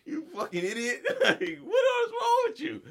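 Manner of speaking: laughing scold; laughing insult. Real, not acted. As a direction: giggly shout at the worker.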